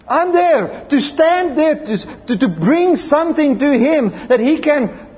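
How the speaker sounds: high, rising preacher's cry of joy; impassioned preaching. Acted. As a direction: lift the congregation with fervour. Preacher building to full force.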